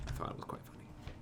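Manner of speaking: fast whispered aside. Real, not acted. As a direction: rush it out in a whisper. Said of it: "unvoiced fast aside".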